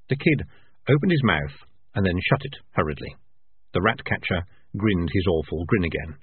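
plain reading voice

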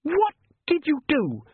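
very high, drawn-out shocked gasp; stricken, high-voiced accusation. A narrator's reading. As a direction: choke the question out slowly, pitched high. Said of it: "she gasped".

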